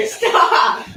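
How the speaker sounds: breathless laugh, cry of stop; laughing so hard it hurts; laughing through pain. Real, not acted. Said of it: "it hurts to laugh".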